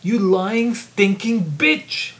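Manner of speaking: explosive rage. Acted. Professor exploding at the lying student, furious yell. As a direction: spit it at her in fury. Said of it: "Regina exploded".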